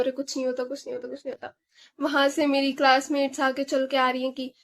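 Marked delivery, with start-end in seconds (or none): 0.0-1.6 soft, fading self-reassurance; soothing herself. Retold or imitated. Crying girl calming herself before classmates, soft murmured reassurance. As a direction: hush yourself down gently, repeating it. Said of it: "मैं अपने आप को शांत कर रही हूँ".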